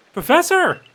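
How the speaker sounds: high, loud call. Acted. Villager calls out to the professor, high and loud. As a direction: hail someone with an eager shout.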